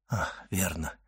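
very soft, low undertone; hushed murmur. A narrator's reading. Doctor to the mute old man, murmured realisation. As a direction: say it under the breath, almost whispering. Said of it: "прошептал Давриньи".

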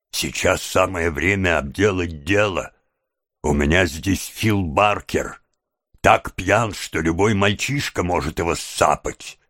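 ordinary delivery, nothing marked

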